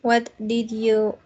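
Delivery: normal talking voice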